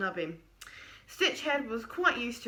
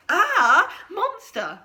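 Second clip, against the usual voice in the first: higher and louder mock shriek; mock scream; 8 semitones higher, 10 dB louder, much slower than usual. Creature imitating Stitchhead's scream, mock shriek. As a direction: ham up a startled cry. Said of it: "and I was all like".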